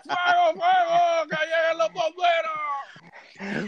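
very high, slow, shouted mock alarm. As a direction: yell fire like the house burns. Joking alarm at friend's return. (grito de alarma)

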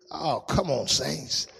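ordinary talk

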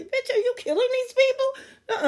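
high incredulous laughing jab; mock-accuse through laughter; amused disbelief. Real, not acted.

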